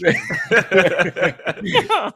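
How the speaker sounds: high, loud laugh breaking out; burst of laughter. Real, not acted. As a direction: finally crack up laughing. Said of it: "couldn't even keep a straight face".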